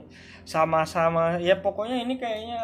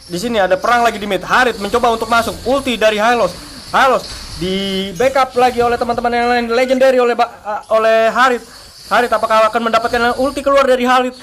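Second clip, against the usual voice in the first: live play-by-play; 4 semitones higher, 9 dB louder, faster than usual. Real, not acted.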